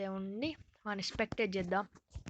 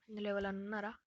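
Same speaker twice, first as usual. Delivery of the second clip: ordinary delivery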